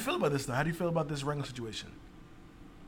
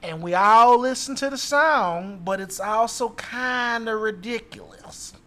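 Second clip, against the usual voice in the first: slow, higher, loud Southern drawl; Southern accent; 5 semitones higher, 7 dB louder, slower than usual. Acted. Reading an angry customer's tweet to the others, in a Southern accent. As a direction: read it out in a drawn-out Southern accent. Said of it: "Can you do a Southern accent?".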